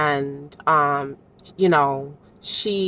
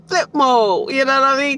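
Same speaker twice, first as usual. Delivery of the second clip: high, louder flip mode; excited; 9 semitones higher, 4 dB louder than usual. Real, not acted. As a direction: hype it up, much higher.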